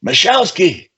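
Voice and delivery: a loud, high call across the room; yelled name. Retold or imitated. Captain calls out for the littlest guy, loud yell. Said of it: "yells out as loud as he can".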